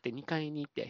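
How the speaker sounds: ordinary talk, nothing marked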